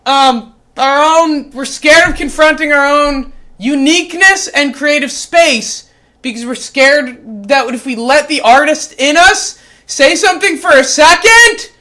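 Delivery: loud, high, preaching shout; yelled sermon. Acted. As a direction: yell it out with conviction. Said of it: "I'm gonna yell it again".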